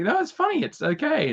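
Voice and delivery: high squeaky reassurance; high-pitched plea; mock pleading. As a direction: talk them round, voice jumping up. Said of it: "So I was like".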